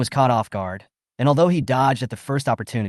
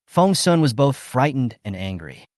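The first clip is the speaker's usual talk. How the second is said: usual speaking voice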